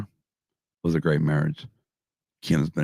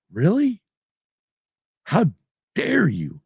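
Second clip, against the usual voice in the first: mock indignation; 12 semitones higher, slower than usual. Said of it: "I'm like".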